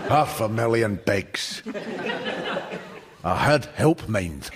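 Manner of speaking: put-on deadpan boast in a light Paisley accent; local pub regular. Pub regular adds his pig farm boast in a slow light Paisley accent. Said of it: "he said".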